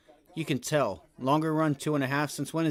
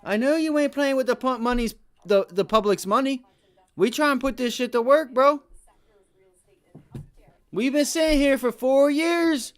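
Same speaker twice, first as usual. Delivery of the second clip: loud and high, calling the Fed out; mock street confrontation with the Fed chair, building to a shout; demanding confrontation; 7 semitones higher, 6 dB louder than usual. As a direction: get up in their face and demand your money, rising louder as you go. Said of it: "get up on them and say".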